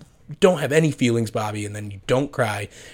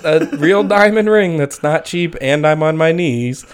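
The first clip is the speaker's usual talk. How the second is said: playful assurance; 7 semitones higher, 6 dB louder than usual. Retold or imitated. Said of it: "I'm like".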